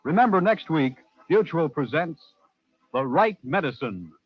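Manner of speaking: ordinary talk, nothing marked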